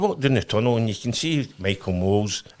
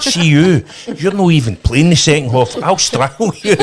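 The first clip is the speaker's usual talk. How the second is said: louder, higher mock rage; mock furious manager; 7 semitones higher, 9 dB louder than usual. Manager threatening a joking player, mock furious yell. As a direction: bark it like a raging boss.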